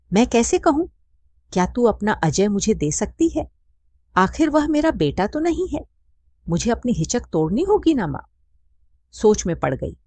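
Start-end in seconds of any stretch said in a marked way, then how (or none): 0.1-8.3 high, hesitant, slightly altered voice. A narrator's reading. Radha hesitantly asks her friend a favour, higher and unsure. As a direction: ask haltingly, not quite daring. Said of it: "Radha झिझकते हुए बोली".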